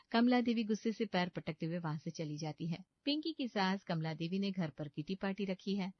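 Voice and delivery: ordinary delivery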